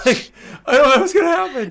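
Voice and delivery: laughing. Real, not acted. Laughing at tense session moment.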